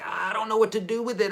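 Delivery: put-on high, whiny clueless kid; a light mock of a lost beginner. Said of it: "and they're like".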